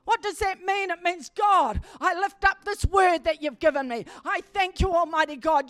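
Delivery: loud, high, fervent preacher's cry; full-force preaching. Acted. Preacher calling the congregation to cry out, loud and fervent. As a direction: preach it out, rousing the whole congregation.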